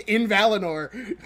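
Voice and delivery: laughing through the words. Real, not acted. Streamer laughing at the show's bad writing. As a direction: burst out laughing at how bad it was.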